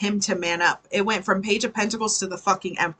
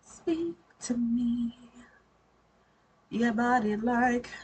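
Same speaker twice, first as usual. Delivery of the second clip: odd put-on voice; 3 semitones higher, 6 dB quieter, slower than usual. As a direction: drawl it out like a strange seducer. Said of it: "what that accent was".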